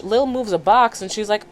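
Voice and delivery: normal talking voice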